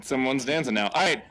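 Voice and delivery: ordinary talk, nothing marked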